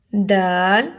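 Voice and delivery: دال with a firm stopped d, long vowel; hard dal. Shown as a demonstration. Teacher contrasts two letters, دال with a plain hard d. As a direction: hold دال long after a clean d. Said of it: "زال ہے یہاں پر".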